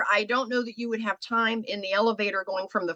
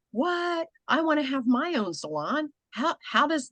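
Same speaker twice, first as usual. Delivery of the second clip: much higher, eager what; excited stylist; 6 semitones higher than usual. Retold or imitated. Eager stylist hearing about own salon. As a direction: sound like a stylist who wants in. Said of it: "every stylist is like".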